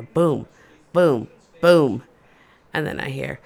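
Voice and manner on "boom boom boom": footsteps boom. Retold or imitated.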